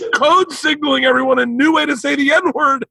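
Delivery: high-pitched mock outrage; exasperated scolding. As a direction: imitate an aghast cousin telling you off. Cousin scolds teller in retold exchange.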